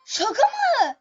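high and loud disbelief; incredulous. Real, not acted. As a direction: cry out in disbelief. Teammates fail with eleven lives. (yüksek sesle şaşkınlık)

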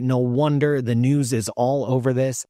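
usual speaking voice

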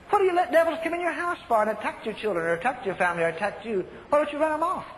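normal talking voice

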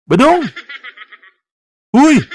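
high, laughing call of his name. Acted. Host calling sleeping guest Badong, mock-worried high call. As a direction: call him awake with a laugh.

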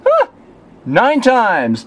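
delighted laughing cry; excited laughing. Real, not acted. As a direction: burst out with joy. Their favorite theme wins.

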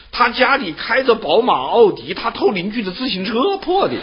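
high, incredulous mockery, laughing at the end; mocking disbelief. Mozi mocking the absurd thief. (墨子高声嘲讽偷破自行车的富人)

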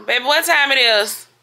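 loud, high call across the room. Real, not acted. Asking her husband the time, called out loudly.

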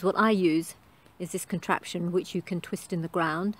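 ordinary delivery

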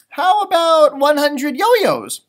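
bright brotherly suggestion; Tom suggesting. Acted. Tom offering an idea at dinner, eager. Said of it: "suggested Tom".

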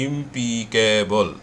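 ইমপিকেবল said very slowly, syllable by syllable; slow syllable demo. Shown as a demonstration. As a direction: stretch out ইমপিকেবল slowly to show its parts. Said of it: "তিনটা শব্দ". (ইমপিকেবল ধীরে ধীরে ভেঙে বলা)

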